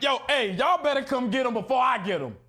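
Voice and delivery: loudly bluff, half-joking, so someone pulls him away; mock yelling. To the crowd, mock threat: hammed-up loud call. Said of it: "I was like".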